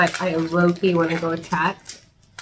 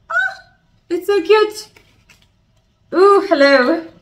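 cooing at plant; 10 semitones higher, 5 dB louder than usual. Real, not acted. Greeting the new begonia in a high cooing voice. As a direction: coo at it like a puppy.

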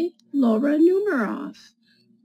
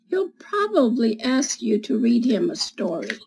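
put-on reading to children. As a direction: read it like storytime for little kids. Reads picture book to children.

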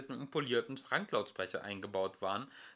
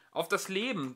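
pitched up, eager and pressing; eager programmer, high and urgent; 8 semitones higher, 4 dB louder than usual. A narrator's reading. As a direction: push it, keen to know.

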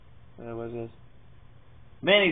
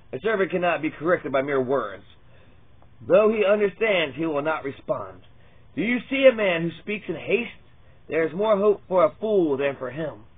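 louder, declaiming Bible reading; scripture reading; 4 dB louder than usual. Acted. Reading Proverbs to his viewers as a preached scripture. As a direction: proclaim each proverb like a preacher.

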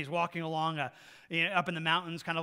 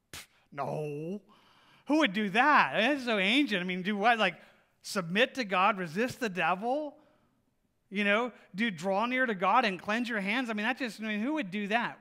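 loud, scoffing mock voice of a careless believer; sarcastic louder sneer at old-fashioned obedience; mocking dismissal; 3 semitones higher, 8 dB louder than usual. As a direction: scoff loudly like it's absurd. Mocking people who ignore God's recipe.

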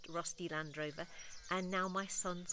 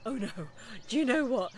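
retold panic; 4 semitones higher, 7 dB louder than usual. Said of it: "The panic, that hot sweat".

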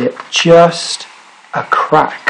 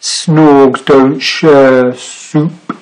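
very slow, firm grumbling refusal; slow grumpy Snorg; much slower than usual. Acted. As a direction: drag each word out stubbornly. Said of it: "said the Snorg".

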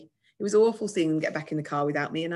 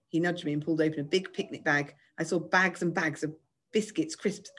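ordinary delivery

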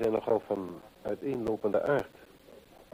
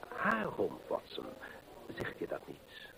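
whispered, much softer pointed question; hushed whisper; 2 semitones lower, 9 dB quieter, slower than usual. Acted. Holmes whispers the hint to Watson.

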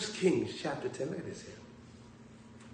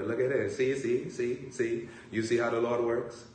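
ordinary delivery

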